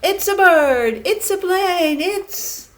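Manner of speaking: high, loud, drawn-out announcer call; excited announcement. Acted. Reporter spotting the flying pig, high excited shout. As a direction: stretch the last words like a big reveal.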